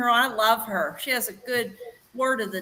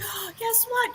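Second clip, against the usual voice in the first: gasp, then a much higher, breathless guess what; excited gasp; 11 semitones higher, 5 dB quieter than usual. Retold or imitated. Telling her husband about winning the bottle, high breathless excitement. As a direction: burst with news you can barely hold in.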